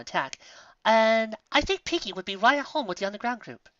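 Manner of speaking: prim and proper; Rarity laying out her friends' roles; Rarity, high and refined. A narrator's reading. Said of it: "Rarity nodded".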